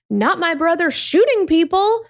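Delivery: usual speaking voice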